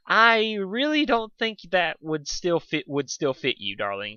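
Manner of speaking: a posh fashionista dismissing his tux; polite laugh. A narrator's reading. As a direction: talk down to him sweetly. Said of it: "with a polite laugh".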